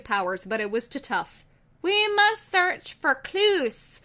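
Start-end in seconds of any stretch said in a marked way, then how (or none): 1.8-4.0 much higher, slow and deliberate; slow, high, resolute declaration; determined detective. Acted. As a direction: announce the plan like a storybook sleuth. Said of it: "I decided".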